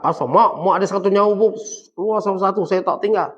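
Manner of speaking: loud mock defiance toward mother; wrong, defiant way. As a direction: push it out loudly, like a rude child. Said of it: "Saat bukan cakap".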